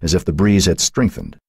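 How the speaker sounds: plain reading voice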